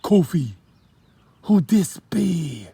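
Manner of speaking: drawn-out Jamaican question; heavy Jamaican accent. Acted. Sean asks Kofi about the stranger, heavy Jamaican accent. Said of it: "he asked with a heavy accent also".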